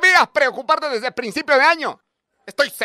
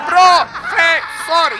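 loud drawn-out yell; 3 semitones higher, 6 dB louder, much slower than usual. Retold or imitated. Teacher demanding to be called professor, slow loud shout. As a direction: stretch the word out, shouting.

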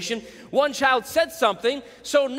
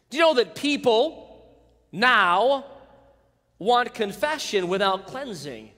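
ordinary talk

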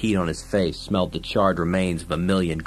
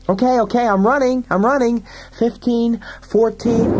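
very high, loud, frantic squawk; frantic panic; 5 dB louder than usual. Acted. Fergie runs from gun and fireball, high loud panic. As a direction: yelp it out in terror.

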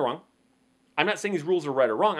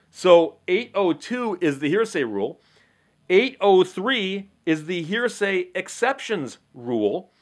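ordinary talk

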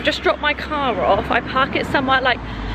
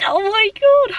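high, loud, drawn-out oh my God; a big breathless gasp of oh my God; excited exclamation; 11 semitones higher, 6 dB louder, slower than usual. Real, not acted. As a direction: burst it out, startled and thrilled. Exclaiming after climbing a hill.

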